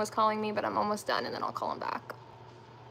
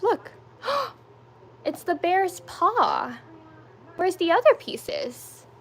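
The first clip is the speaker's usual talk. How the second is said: excited gasp; 7 semitones higher, slower than usual. Real, not acted. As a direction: gasp and marvel at the bear's paw. Mom cheering child's puzzle.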